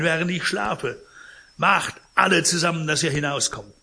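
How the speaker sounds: angry rebuke. Acted. Dying Pons throws the visitors out, loud and bitter. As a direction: drive them out in anger.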